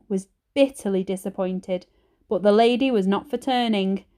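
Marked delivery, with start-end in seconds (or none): none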